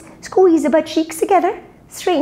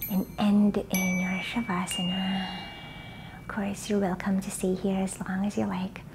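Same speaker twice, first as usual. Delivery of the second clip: soft unvoiced relaxation whisper; whispered cool-down; 5 semitones lower, 11 dB quieter than usual.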